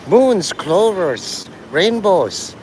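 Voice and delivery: high, drawn-out cartoon Irish voice. Shown as a demonstration. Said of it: "I could go all stereotypical".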